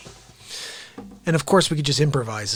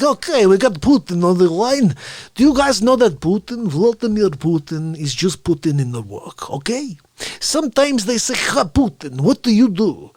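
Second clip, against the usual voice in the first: loud, raised voice acting out a phone call; hammed-up Putin bit; 7 semitones higher, 9 dB louder than usual. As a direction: play both sides of a comic call.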